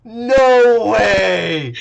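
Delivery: shouted no way, still reeling; loud disbelief. Real, not acted. GM shouts again over the lucky roll. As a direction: yell it like the impossible just happened.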